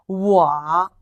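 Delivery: normal talking voice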